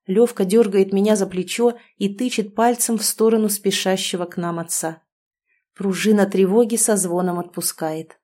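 ordinary delivery, nothing marked

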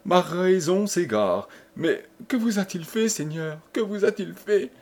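high, anguished pleading; frantic despair. Acted. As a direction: beg for an answer in rising panic. Desperate wife pleads with father-in-law.

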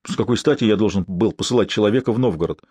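ordinary delivery

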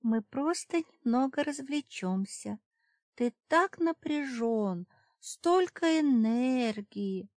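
high, slow cooing temptress; breathy purring seductress. A narrator's reading. Succubus seduces the guard.

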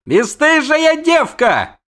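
very high, loud, drawn-out shout; roared insult. A narrator's reading. Prince is scandalised by the siren, roaring. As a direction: bellow it in outrage. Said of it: "рявкнул он".

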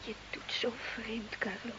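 whispered, barely voiced; worried whisper. Wife quietly confronting her changed husband. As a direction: let the worry slip out softly.

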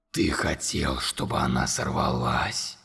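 whispered monster. A narrator's reading. Monster whispering accusation to Conor. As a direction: hiss the accusation.

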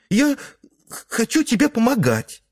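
put-on very high child voice with a stammer; squeaky child. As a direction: plead like a small boy. Son begs mother.

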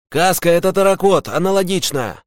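very high-pitched fighter's call; urgent radio report. Acted. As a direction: another soldier calling in under attack. Second fighter reports over radio. (срочный доклад бойца, высоким голосом)

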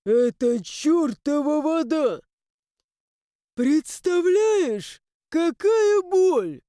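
high, slow, wailing lament; tragic moan. A narrator's reading. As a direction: lament like a suffering ghost. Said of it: "трагично простонал призрак".

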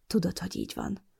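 soft, hushed reply; whispered. Acted. Mason whispers his love to Molly with eyes closed, hushed.

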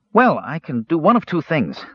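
lightly voiced chirpy kender; a hint of a cheerful kender. A narrator's reading. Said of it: "said Earwig to himself".

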